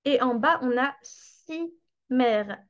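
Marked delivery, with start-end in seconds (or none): 1.0-2.6 si mère said slowly, each syllable apart; slow verlan demo. Shown as a demonstration. Teacher showing students merci reversed into si mère, slowly. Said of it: "c'est merci à l'envers".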